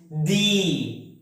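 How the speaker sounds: "the" said as thee, with long ee; long ee vowel in "the"; strong form of the. Shown as a demonstration. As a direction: draw out "the" to rhyme with see. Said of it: "This word is pronounced".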